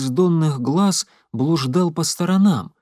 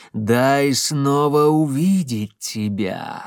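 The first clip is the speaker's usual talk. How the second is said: luring call; much slower than usual. Acted. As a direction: lure her out slowly. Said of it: "зазывал голос".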